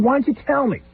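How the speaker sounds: high exasperated question. Retold or imitated. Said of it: "I said".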